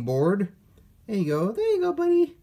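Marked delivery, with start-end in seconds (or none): 1.5-2.4 put-on high, babyish voice to a tiny toy; cutesy voice. Unboxer talking to the toy as a pet, cutesy. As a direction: coo at the little figure.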